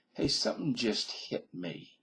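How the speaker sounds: ordinary delivery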